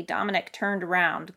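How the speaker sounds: ordinary delivery, nothing marked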